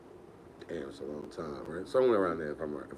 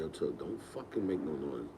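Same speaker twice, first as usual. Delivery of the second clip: hushed warning; 5 semitones lower, 7 dB quieter than usual. Retold or imitated. Sneaking in late, parents asleep.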